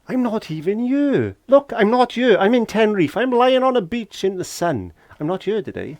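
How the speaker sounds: cheeky excited brag about Tenerife; playful boast. Pretend. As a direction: crow about being away on a beach. Joking he's in Tenerife.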